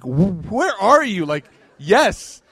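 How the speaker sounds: high, loud retold exasperation; exasperated retort. Teacher retelling his retort to student, exasperated. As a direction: drag it out, incredulous and loud.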